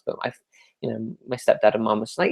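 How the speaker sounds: normal talking voice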